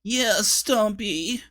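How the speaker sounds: high, slow listless emo drawl; bored and gloomy. A narrator's reading. Emo dwarf backing up his leader, listless emo voice. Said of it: "said the emo Clingy".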